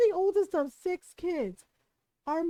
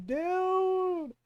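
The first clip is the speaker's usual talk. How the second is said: higher drawn-out "dude"; appalled disbelief; 5 semitones higher than usual. Retold or imitated. Sibling repeating the reaction to brother's blunder, appalled and high. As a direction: hold one long high note of disbelief.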